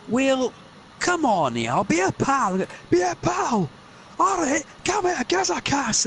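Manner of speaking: high, wheedling Scots sweet-talk; coaxing Scottish voice. Acted. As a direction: lure him in close to headbutt. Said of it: "he's gonna be like".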